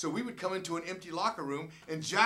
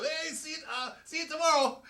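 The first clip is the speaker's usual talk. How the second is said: high, bright call-out; cheery goodbye; 13 semitones higher, 6 dB louder than usual. Retold or imitated. Player riding off from fans, high cheery farewell. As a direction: wave them off playfully as you ride. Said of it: "and I'd go".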